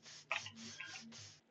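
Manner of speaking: soft imitated hissing tss; hissing sound. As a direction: hiss like a fizzing fuse. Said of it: "j'ai entendu un tss".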